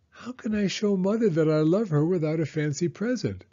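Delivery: a small turtle asking his father, voiced high; young turtle asking his father for help. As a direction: ask it up high like a young child. Franklin asks his father.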